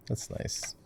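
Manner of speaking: a whispered, dry that's nice; soft whispered remark; whispered aside. Real, not acted. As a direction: breathe it under your voice like an aside. Whispered aside after tips.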